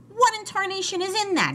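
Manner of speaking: very high, startled demand; storybook splutter of outrage; appalled outburst. A narrator's reading. Spat out cake, demands explanation.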